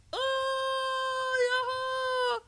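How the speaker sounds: very high, loud held yells; bear's wild holler. A narrator's reading. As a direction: holler with playful abandon. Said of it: "знову заволав ведмедик".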